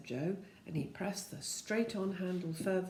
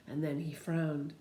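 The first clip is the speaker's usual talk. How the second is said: ordinary delivery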